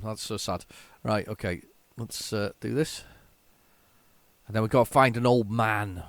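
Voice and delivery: ordinary talk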